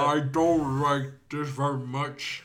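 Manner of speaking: slow, low lumbering giant; Andre the Giant voice. Retold or imitated. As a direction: rumble it slowly like a giant. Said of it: "my impromptu Andre the Giant impression".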